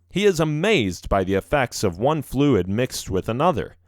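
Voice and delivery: ordinary delivery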